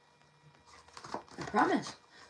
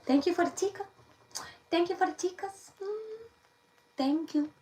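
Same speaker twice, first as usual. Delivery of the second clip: high thank-you; 10 semitones higher than usual. Acted. Thanking viewers for tips.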